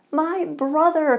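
slow, high and loud, brotherly delight; overjoyed man greeting a brother in faith; joyful cry. Acted. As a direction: cry it out warmly. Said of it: "cried the other man".

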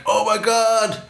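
high, mock-amazed exclamation; imitated excitement. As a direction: gush like an excited gang member. Quotes the excited gang.

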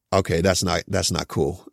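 low, deadpan retold reply; mock offended. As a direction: drop it low, playfully unimpressed. Retold reply to teasing kid.

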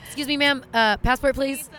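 mock border officer. Friend plays the border officer, high and loud. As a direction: play the border guard asking for a passport. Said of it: "so let's hear it, [name]".

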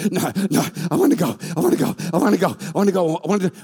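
rapid, repeated excited begging; impatient eagerness. Acted. Holy Spirit eager to interrupt Peter's preaching, impatient and insistent. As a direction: gabble it like a dog bursting to get out. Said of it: "And the Holy Spirit's like".

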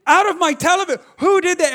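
put-on exasperated parent grilling the kids; angry interrogation. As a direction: build from accusing to a loud demand. Retold angry questioning of his kids.

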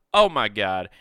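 usual speaking voice

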